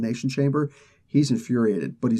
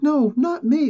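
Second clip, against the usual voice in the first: panicked plea; 13 semitones higher, 4 dB louder, slower than usual. Retold or imitated. Victim pleading before being killed.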